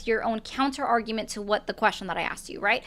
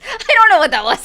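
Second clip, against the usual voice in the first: horse laugh; 8 semitones higher, 10 dB louder than usual. Pretend. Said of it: "That was not my witch laugh".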